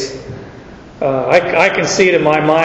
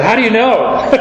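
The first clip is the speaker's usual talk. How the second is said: put-on eager young self; 5 semitones higher than usual. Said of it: "I said".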